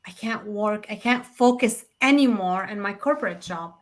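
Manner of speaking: usual speaking voice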